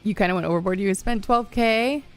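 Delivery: ordinary talk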